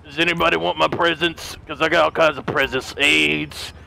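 very low, deadpan Santa; seedy mall Santa. Acted. Santa offering awful presents to everyone, seedy. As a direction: offer gifts in a deep, shady Santa voice.

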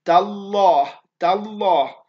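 تالله said with a thick, emphatic dark L; heavy L. Shown as a demonstration. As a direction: stress the heavy L in تالله clearly. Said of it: "لابد أن أقول".

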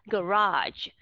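"garage" drawn out very slowly, ending in a j sound; Americanized garage. Shown as a demonstration. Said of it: "nó sẽ ra là".

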